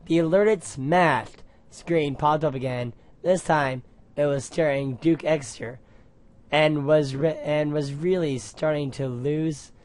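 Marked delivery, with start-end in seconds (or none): none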